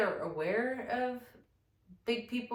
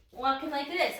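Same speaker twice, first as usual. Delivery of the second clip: normal talking voice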